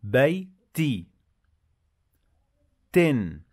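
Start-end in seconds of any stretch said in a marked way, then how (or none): 0.0-1.1 بيتي drawn out slowly, higher; teacher sounds out the word; syllable demo. Shown as a demonstration. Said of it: "تعالوا نضيف".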